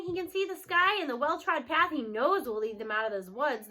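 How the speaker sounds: usual speaking voice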